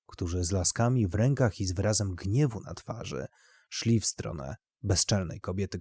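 ordinary delivery

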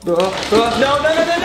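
rising panicked no's. Real, not acted. Panicking as something goes wrong.

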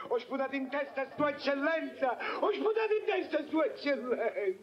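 panicked clerk wailing he spat on the boss; rising panic. Acted. Panicked repetition of disaster.

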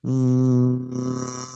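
long, low, steady hum; low monotone mains hum; imitated hum. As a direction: drone on one deep note like electrical hum. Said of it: "except for that hum".

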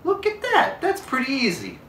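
high squeaky delight. Acted. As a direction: squeal with pleasure.

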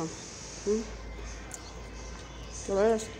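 usual speaking voice

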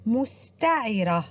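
slow clear mustaʕirah; pausal ta marbuta. Shown as a demonstration. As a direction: stop on مستعرة with the ta marbuta as h. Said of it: "التاء المربوطة عند الوقوف عليها لفظت هاء".

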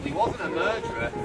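normal talking voice

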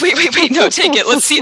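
husband panicking to stop her burning the proof; startled. Retold or imitated. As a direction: blurt it out, suddenly alarmed. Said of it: "he kind of jumps and goes".

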